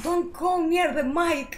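calling out. Real, not acted. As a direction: call it out slowly and loudly. Calling out to test the mic.

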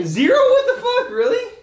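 very high laughing squeal; shocked disbelief. Real, not acted. Shocked at the zero rating.

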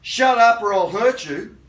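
loud, menacing attacker's shout; threatening yell. Retold or imitated. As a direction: bark the threat at the victim. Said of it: "your attacker is yelling at you saying".

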